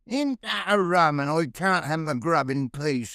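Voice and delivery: high, slow Suffolk grumble; grumbling Suffolk plumber. Acted. Plumber to his wife as the phone interrupts tea, grumbling. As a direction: complain as an old Suffolk tradesman. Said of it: "a bit more Suffolk logic".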